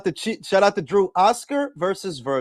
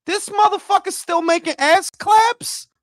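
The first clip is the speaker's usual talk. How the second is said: disbelief; 13 semitones higher, 6 dB louder than usual. Real, not acted. Reacting to news, disbelief.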